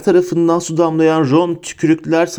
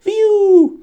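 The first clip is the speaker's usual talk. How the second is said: very high, loud whoop; whooping cry; 5 dB louder than usual. A narrator's reading. Prankster ghost launches a water bomb, high gleeful whoop. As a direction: let out a long, high "wheee".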